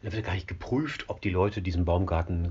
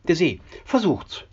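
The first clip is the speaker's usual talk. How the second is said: high, a bit louder taunting call; taunting onlooker; 12 semitones higher, 5 dB louder than usual. Acted. Onlookers taunting the ferryman from shore, high mocking call. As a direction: jeer it up high at him.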